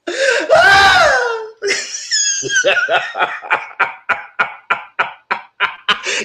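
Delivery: high screaming laugh; howling laugh-scream; shrieking laugh. Real, not acted. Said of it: "Everybody said".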